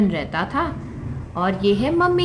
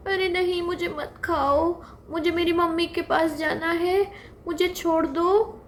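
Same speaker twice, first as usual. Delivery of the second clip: little deer begging to go to its mother; mock crying fawn; 7 semitones higher than usual. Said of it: "छोटा हिरन तो रोने लगा". (हल्का-सा रोता हुआ बच्चा)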